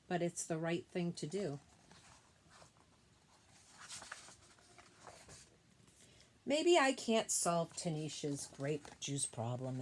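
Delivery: gentle, measured read-aloud voice; storybook reading voice; reading to children. Acted. Reads picture book to class.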